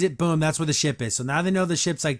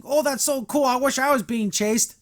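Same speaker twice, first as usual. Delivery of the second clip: higher-pitched mock delight; happy; 7 semitones higher than usual. Friend thrilled to hear about a chase, mock-happy. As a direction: gush happily, imitating a thrilled friend. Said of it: "Glimmer's happy that's happening".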